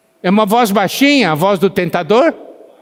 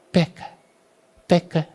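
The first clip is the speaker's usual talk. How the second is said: hushed, softer tempter's urging; 3 semitones lower, 7 dB quieter than usual. Acted. The enemy tempting a believer to sin, very softly. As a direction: drop to a soft, sneaky little prompt. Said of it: "bem baixinho pra você".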